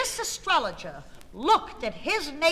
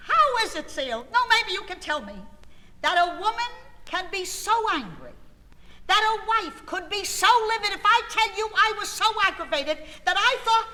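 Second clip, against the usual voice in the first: higher and louder indignant rant; livid; 8 semitones higher, 3 dB louder than usual. Real, not acted. Wife telling [name] how livid she was, high and heated. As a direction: spill over with fury.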